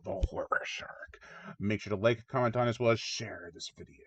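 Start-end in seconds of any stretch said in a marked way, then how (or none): 0.0-1.2 slow, drawn-out whispered stage name; spooky whisper. Pretend. Host signing off as the Horror Shark, spooky whisper.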